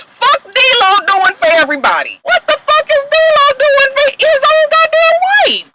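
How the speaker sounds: very high, almost crying with disbelief; high-pitched indignant wail; outraged squeal. Real, not acted. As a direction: wail it half-laughing. Laughing outrage.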